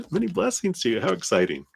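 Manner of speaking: ordinary talk, nothing marked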